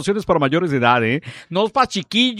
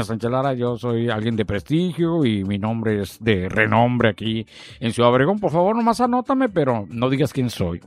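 prim gentleman; 10 semitones lower, 3 dB quieter than usual. Retold or imitated. DJ imitating a modest wealthy caller, lightly lowered. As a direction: lightly voice a discreet rich caller. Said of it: "muy recatados".

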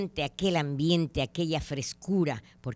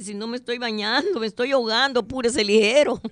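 higher and louder, frantic and hurried; desperate plea; 6 semitones higher, 7 dB louder than usual. Retold or imitated. Drowning man begging the ranger to hurry, panicked plea. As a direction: beg for rescue in a panic.

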